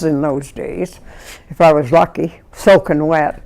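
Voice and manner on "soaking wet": ordinary talk, nothing marked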